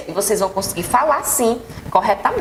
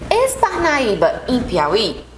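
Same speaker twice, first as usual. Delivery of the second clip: slow question model; much slower than usual. Shown as a demonstration.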